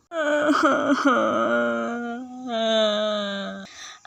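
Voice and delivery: long mock sob; crying. Mother crying at the daughter's farewell. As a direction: wail through a laugh.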